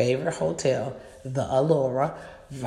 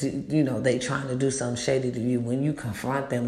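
usual speaking voice

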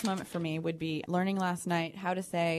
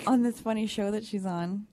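usual speaking voice

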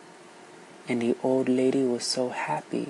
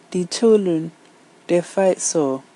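louder, higher wailing complaint; woman's lament; 5 semitones higher, 7 dB louder than usual. Acted. The woman tells the elephant of fighting children, higher and loud. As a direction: plead like a worn-out mother.

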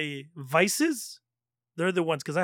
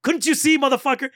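higher, louder, reliving the fury; pitched-up furious retold yell; retold angry outburst; 8 semitones higher, 5 dB louder than usual. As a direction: snap it at the guy like you did back then. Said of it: "So me, I get angry right away".

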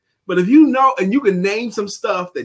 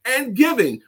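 high, lively quote reading; enthusiastic reading; 7 semitones higher than usual. Acted. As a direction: read it like a cheer.

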